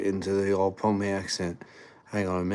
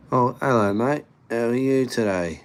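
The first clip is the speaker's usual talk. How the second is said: higher, chirpy mock British accent; Pommy accent; 4 semitones higher, 3 dB louder than usual. Traveller copying UK locals on arrival, higher mock British accent. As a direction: greet someone in a put-on Pommy accent. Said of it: "talking in a bit of a Pommy accent".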